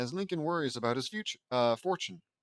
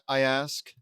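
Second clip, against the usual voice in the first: usual speaking voice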